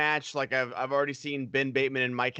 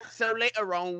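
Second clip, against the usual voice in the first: normal talking voice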